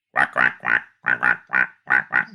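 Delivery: mock duck quacks in a row. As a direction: imitate a beach full of ducks quacking. Beach covered in ducks.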